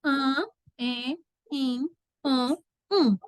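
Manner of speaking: "An, en, in, an, um" as separate nasal vowels; isolated nasal sounds, "An, en, in, an, um"; nasal vowel series. Shown as a demonstration. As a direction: sound out "An, en, in, an, um" one at a time with pauses. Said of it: "Dígrafo".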